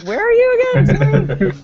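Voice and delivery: high, drawn-out teasing question; mock puzzlement. Guest seeing the little flag, high mock confusion. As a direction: stretch it out in playful bafflement.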